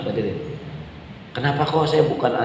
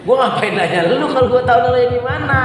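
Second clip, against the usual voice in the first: higher, fast, fed-up retort; exasperated husband; 8 semitones higher, faster than usual. Retold or imitated. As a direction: snap back at the wife, exasperated. Husband retorts to wife's question. (suami kesal membalas istri, nada tinggi dan cepat)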